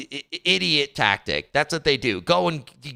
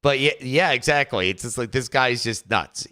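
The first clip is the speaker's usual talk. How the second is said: ordinary talk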